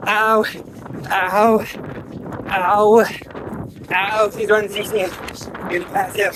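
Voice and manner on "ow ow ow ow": high repeated yelps of pain. Real, not acted. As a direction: yelp each ow as feet burn. Feet burning on hot sand.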